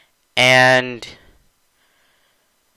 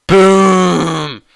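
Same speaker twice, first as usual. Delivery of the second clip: punchy goal-call boom, louder; excited boom; 5 semitones higher, 6 dB louder than usual. Real, not acted. Goal call in game recap.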